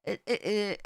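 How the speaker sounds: ordinary talk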